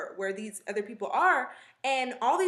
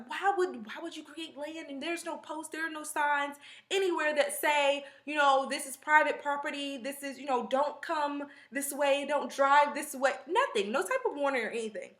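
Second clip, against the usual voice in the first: retold protest in a higher pitch; higher-pitched retold objection, not shouted; elevated voice; 4 semitones higher than usual. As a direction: lift the pitch, recounting an incredulous complaint. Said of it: "my voice has become elevated".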